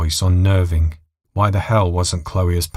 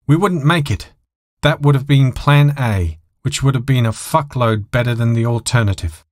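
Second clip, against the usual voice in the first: frustrated; 6 semitones higher than usual. A narrator's reading. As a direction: give in with a curse. Pilot frustrated giving in.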